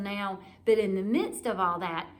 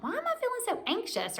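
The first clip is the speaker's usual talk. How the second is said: much higher, fast, anxious inner voice; anxious self-questioning; 13 semitones higher, faster than usual. Retold or imitated. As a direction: ask yourself in a high, fast, bewildered pitch. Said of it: "And I'm like".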